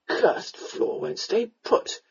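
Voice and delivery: slow, unvoiced tipsy mutter; drunken muttering. A narrator's reading. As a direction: mutter slowly to yourself, drunk. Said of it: "he muttered".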